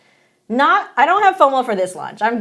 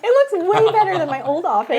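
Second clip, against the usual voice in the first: higher-pitched protesting comeback; retold retort; 9 semitones higher than usual. New hire defending the office to coworkers, protesting. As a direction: insist on it in a raised pitch. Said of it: "I'm like".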